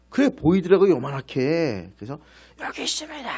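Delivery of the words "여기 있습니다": calling out. Retold or imitated. Calling up to the commander.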